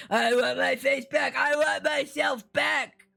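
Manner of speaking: shouted, desperate, dragged out; desperate screamed demand; screaming. Acted. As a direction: scream it at full lungs. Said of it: "screaming at the top of her lungs".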